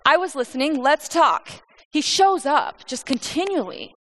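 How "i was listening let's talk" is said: high, loud, friendly greeting; upbeat, raised-voice hello to an old friend; casual God. Acted. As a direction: play God cheerfully dropping in for a chat. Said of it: "and then God's like".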